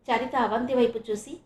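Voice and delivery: usual speaking voice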